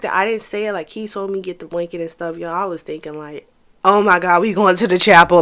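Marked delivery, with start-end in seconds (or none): 3.8-5.4 loud, giddy Oh my God; blurting out excitement; thrilled inner voice, loud; excited gasp. Retold or imitated. Excited inner thought, wedding dreams.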